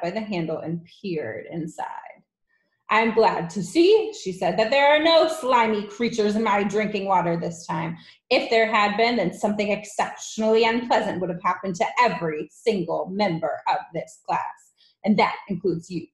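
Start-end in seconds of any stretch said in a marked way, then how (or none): 2.9-4.1 loud, higher, imperious voice; loud and threatening headmistress; domineering headmistress. Acted. Trunchbull addresses the class. 4.6-16.1 loud threat to the whole class; imperious headmistress voice; domineering headmistress. Acted.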